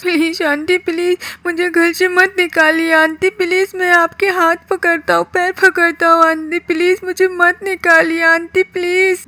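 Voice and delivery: put-on pleading kid Kroots. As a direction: beg the aunt not to throw you out.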